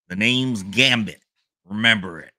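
unconvincing Cajun hero voice; attempted Cajun accent. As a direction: have a poor go at a Cajun accent. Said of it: "That was not a Cajun accent".

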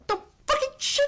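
very high, cracking whine; squeaky crying curse. Retold or imitated. Dad cursing at night, squeaky crying whine. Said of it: "He goes".

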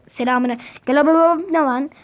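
normal talking voice

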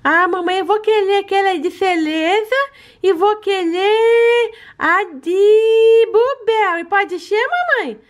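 high, sweet little pet voice begging for treats; baby-voiced pet. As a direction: talk like a greedy cute puppy asking mommy. [name] asks.

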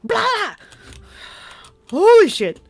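loud, high shocked cry; jolted by a sudden scare; startled outburst. Real, not acted. Player jolted by the monster, loud high outburst.

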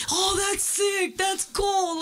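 put-on high, delighted teen exclaiming; imitating son's excitement. Mother voicing her son's delight at paying by card, a high excited exclaim.

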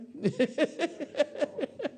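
real laugh, high and rising; a real, high-pitched laugh. Real, not acted. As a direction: burst out laughing at his own joke. Laughing with the gentlemen.

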